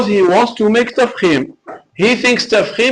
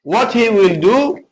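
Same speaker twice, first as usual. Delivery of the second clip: ordinary delivery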